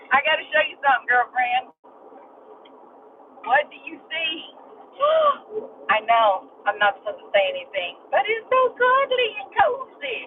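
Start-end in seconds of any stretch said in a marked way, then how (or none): 5.0-5.6 a sharp, high mock gasp of delight. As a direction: gasp as if charmed by something cute. Reacting to something cuddly and cozy.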